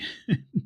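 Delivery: high, breathy burst of laughter; old lady's high laugh. A narrator's reading. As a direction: laugh out delightedly. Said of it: "the grandmama kept on laughing".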